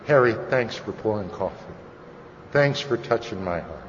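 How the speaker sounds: low, soft, heartfelt gratitude; tender thanks. Retold or imitated. Speaker thanking the coffee pourer he never told, low and tender. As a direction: say it quietly, as an overdue thank-you. Belated thanks to a lost helper.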